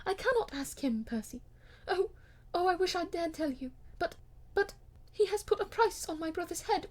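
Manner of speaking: high, tearful, breaking plea; near tears. Acted. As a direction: hold back a sob as you plead.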